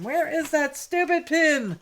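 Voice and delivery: high, loud grumble; mock annoyed. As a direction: fake frustrated cussing. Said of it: "you'll hear me cussing".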